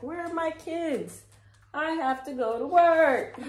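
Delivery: high, loud frantic parent; hammed-up worried parent hunting for kids; mock agitated parent. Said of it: "somebody's getting mad and agitated".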